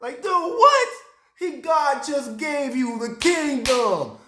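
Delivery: high, incredulous outburst easing into drawn-out protest; worked up. Real, not acted. Preacher scolding Jeroboam over the kingdom, worked up. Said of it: "I get worked up".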